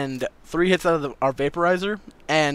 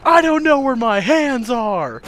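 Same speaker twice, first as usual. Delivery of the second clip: high, loud, drawn-out cry; slow, high retold freak-out; panicked wail; 9 semitones higher, 6 dB louder, slower than usual. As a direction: wail in bewildered stoned panic. Kid panicking about his hands.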